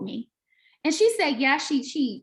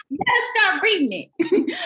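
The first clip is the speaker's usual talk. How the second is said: laughing through a playful scold, higher; laughing tease; 7 semitones higher, 4 dB louder than usual. Real, not acted. Teasing friend about the book.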